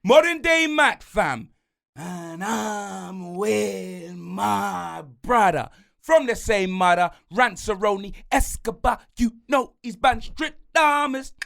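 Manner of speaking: big, punchy announcer-style self intro; hype host intro. Acted. Host introduces himself and his brother, hyped. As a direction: hype yourself up like a ring entrance.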